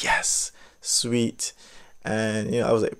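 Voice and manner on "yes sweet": whispered yes, sweet; relieved whisper. Retold or imitated. Relieved at church officer.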